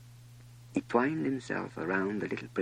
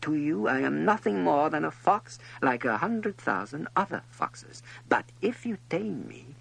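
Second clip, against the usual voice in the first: lightly voiced fox, higher pitched; earnest higher character voice, explaining with care; 7 semitones higher, 6 dB louder than usual. A narrator's reading. Fox explains taming to the little prince, earnest and insistent.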